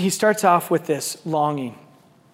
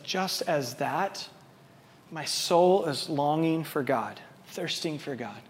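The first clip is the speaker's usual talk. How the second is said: tender reading; 2 semitones lower, 8 dB quieter, slower than usual. Acted. Psalm read softly, slowly.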